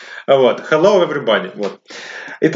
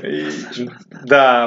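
ordinary delivery

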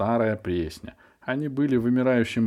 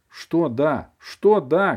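raised alarmed voice; shouting; 6 semitones higher, 6 dB louder, much slower than usual. A narrator's reading. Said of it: "кричал Колупай".

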